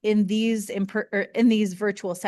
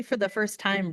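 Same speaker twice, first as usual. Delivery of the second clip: usual speaking voice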